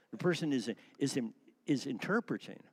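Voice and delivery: ordinary talk, nothing marked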